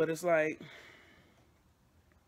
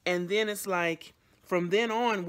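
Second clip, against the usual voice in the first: ordinary delivery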